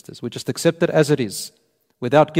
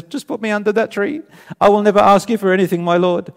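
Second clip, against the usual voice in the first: high, insistent begging, pressing faster and louder; urgent pleading; 7 semitones higher, 5 dB louder than usual. Acted. Man begging his Lord for the tree, urgent pleading. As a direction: promise eagerly, raised voice hurrying on.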